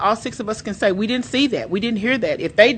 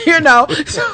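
laughing high and loud; laughing while talking; 9 semitones higher, 4 dB louder than usual. Real, not acted. Laughing at own story.

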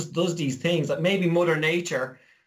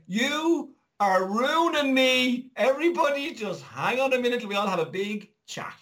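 Mother Nature; 8 semitones higher than usual. Acted. Fed-up planet calling humanity in for a chat, mock scolding. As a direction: stretch out the scolding as an exasperated higher voice. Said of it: "Mother Nature just kind of developed it and just went".

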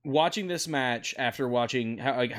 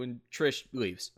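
usual speaking voice

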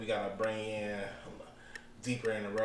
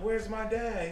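put-on kid's voice; 10 semitones higher, slower than usual. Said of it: "And I'm like".

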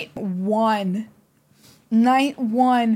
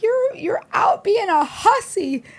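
high, slow mock-scolding granny voice; hammed-up scolding grandma; imitating grandmother; 9 semitones higher than usual. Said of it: "She's like".

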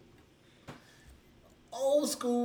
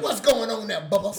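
loud call-out, imitating an old-timer; retold hearty greeting; 8 dB louder than usual. Friend retells old school greeting Shun, hearty and loud. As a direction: imitate a big laughing hello.

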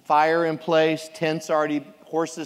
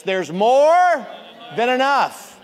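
higher, louder proclamation of "more"; congregation call-and-response; 7 semitones higher, 7 dB louder, slower than usual. Shown as a demonstration. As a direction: lead the church to repeat it loudly. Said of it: "Say this".

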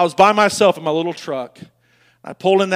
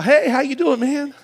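fake cheerful greeting; 7 semitones higher than usual. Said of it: "put on my face, my mask".

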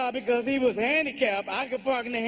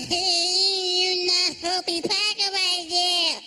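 higher, slow brother voice; imitating his brother; 7 semitones higher, much slower than usual. Comic brother talking to the driver, high and slow. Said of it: "he started talking".

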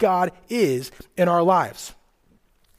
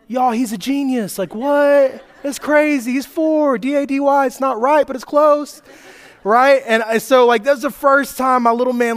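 much higher and louder, gushing with pride; proud excitement; 8 semitones higher, 6 dB louder than usual. Real, not acted. Preacher gushes to the congregation about his four-year-old, much higher and louder.

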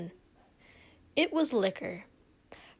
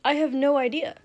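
higher, cheery servant's lilt; far too chipper; 4 semitones higher, 4 dB louder than usual. A narrator's reading. Servant to his hungover prince, far too chipper. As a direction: chirp it, far too pleased. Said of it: "sounding far too chipper about it".

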